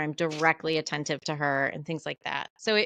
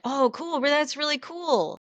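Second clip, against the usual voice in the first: hollow fake interest; 8 semitones higher than usual. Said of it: "it's the same thing where I'm like".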